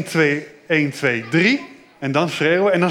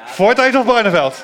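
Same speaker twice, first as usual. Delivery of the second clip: loud, raised call to a crowd; 5 semitones higher, 5 dB louder than usual. Real, not acted. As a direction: rouse the room with a shouted question. Host rallies crowd to shout.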